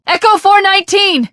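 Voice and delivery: high, loud desperate call over the radio; loud, high radio shout; urgent shout. Acted. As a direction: yell for the pilot who isn't answering. Shouting for the dropship pilot.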